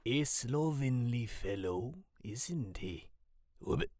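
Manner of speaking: slow, hushed creature voice; confiding aside. A narrator's reading. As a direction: lean in with a slow, odd-voiced murmur. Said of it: "Gligoro whispers to Samuel".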